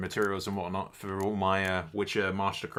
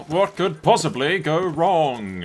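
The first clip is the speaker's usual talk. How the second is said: sarcastic; 6 semitones higher, 7 dB louder, much slower than usual. Acted. Streamer taunts fate, drawn out.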